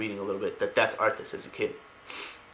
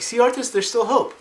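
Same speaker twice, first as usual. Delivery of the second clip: put-on very high, louder childlike voice; boy's voice; 11 semitones higher, 3 dB louder than usual. Boy tells Arthas there's hope, very high. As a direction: squeak it out as a hopeful kid. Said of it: "the boy's like".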